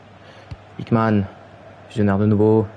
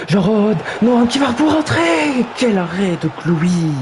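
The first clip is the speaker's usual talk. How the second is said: rising high call into a slow exclamation; excited near miss; 7 semitones higher than usual. Acted. Commentator calling a near goal and the keeper's save, high and excited. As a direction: climb with the attack, then marvel at the save.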